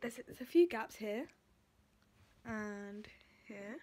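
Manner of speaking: lip held aside by finger; talking while showing braces; finger pulling lip. Real, not acted. Seen 3: Finger pulls lip aside, showing braces, while speaking; brief release near 2.5.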